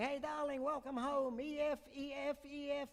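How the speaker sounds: high-pitched wife calling up from the wharf. Retold or imitated. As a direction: sing out to her husband on the bridge. Wife calls up to husband.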